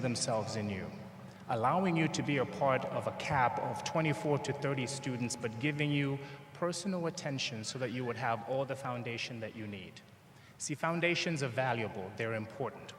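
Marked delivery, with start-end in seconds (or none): none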